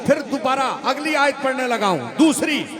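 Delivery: full-force preaching. Acted. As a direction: fire up the crowd. (जोश भरी तक़रीर)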